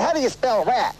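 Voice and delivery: put-on a young Southern boy asking how to spell rat; kid's Southern accent. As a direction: imitate a Memphis kid asking. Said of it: "the Southern accents".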